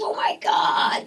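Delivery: imitated husky, excited shout; amazed outburst. Director hearing she's from Brooklyn, astonished shouting. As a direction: burst out in mock astonishment.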